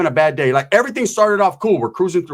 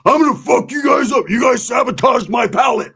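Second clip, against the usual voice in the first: threatening shout; 7 semitones higher than usual. Retold or imitated. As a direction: threaten them with a pipe. Roach threatening with pipe.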